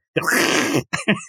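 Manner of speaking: hammed-up vampire-like hiss; mock hiss. [name] turns on Fiona, hissing at her. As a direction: hiss at a rival, playfully. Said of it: "[name] turns and hisses at her".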